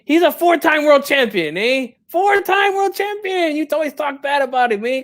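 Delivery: high-pitched, pleading protest; wounded, high-voiced objection; indignant defence. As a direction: protest on your son's behalf, voice climbing. Indignantly defending his son.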